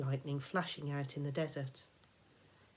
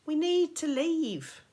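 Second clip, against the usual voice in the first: slow, higher, deliberate urging; urgent plea; 11 semitones higher, 6 dB louder, slower than usual. Acted. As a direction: spell it out so she listens. Percy urges Annabeth.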